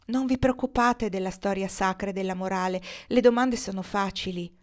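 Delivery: usual speaking voice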